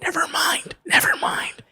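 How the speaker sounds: whispered mock-panicked retraction; urgent whispered warning. Friend secretly calling off the introduction, urgent whisper. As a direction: hiss it under the breath to call it off.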